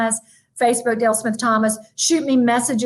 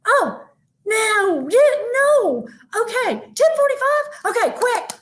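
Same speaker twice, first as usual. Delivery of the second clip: flustered surprise; 10 semitones higher, slower than usual. Real, not acted. Speaker told her slot ends soon, high and flustered. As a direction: panic at running out of time, pitched way up.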